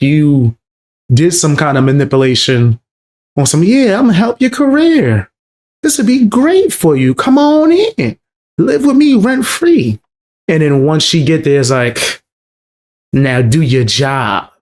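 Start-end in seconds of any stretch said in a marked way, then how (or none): 3.6-10.0 much higher, sugary mocking voice, slowing at the end; mock sweet pitch. Mocking his pitch to her. 13.1-14.5 mock cold order. Mocking his turn after luring her.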